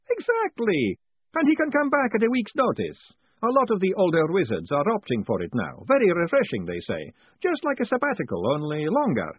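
put-on high, lively old professor; chatty academic explaining cheerfully; professor's voice. A narrator's reading. Professor chats to Moist, high and lively.

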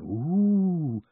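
long rising and falling ooh; high, drawn-out "ooh" of a crowd; crowd's ooh. Acted. The crowd reacts to the news, long high ooh.

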